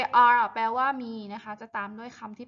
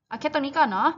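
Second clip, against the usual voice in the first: usual speaking voice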